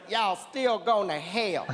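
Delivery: sassy parting jab. Retold or imitated.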